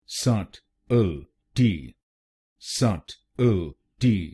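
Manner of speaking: subtlety stretched out very slowly, rising at the end; slow syllable by syllable. Shown as a demonstration. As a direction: spell out subtlety by sound, syllable by syllable. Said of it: "तो बनेगा".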